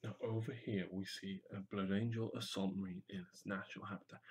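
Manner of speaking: soft, low documentary narrator; nature documentary. Shown as a demonstration. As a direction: hush it like Attenborough. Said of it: "You could do a bit about Irwin and Attenborough".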